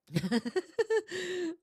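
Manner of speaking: high-pitched giggling laugh; amused high laugh, trailing off; real laugh. Real, not acted. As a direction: burst into a high, rippling giggle. Laughing at a viewer's comment.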